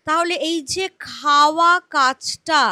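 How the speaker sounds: ordinary delivery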